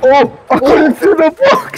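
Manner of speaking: excited. Real, not acted. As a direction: cheer the wallbang kill. Excited kill.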